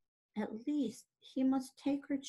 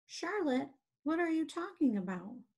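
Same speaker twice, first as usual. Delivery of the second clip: higher-pitched, alarmed question; dismayed cry; 6 semitones higher than usual. Acted. Wilbur questioning his friend Charlotte, dismayed. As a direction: cry it out in mild disbelief. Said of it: "he cried".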